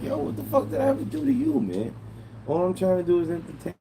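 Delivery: high, pleading outrage; exasperated plea. Retold or imitated. Streamer retelling his plea to Bebop, high and exasperated. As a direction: cry out in wounded disbelief. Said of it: "I'm like".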